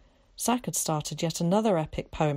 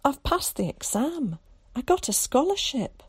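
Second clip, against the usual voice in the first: excited; 7 semitones higher than usual. Acted. Carrie bursting with news.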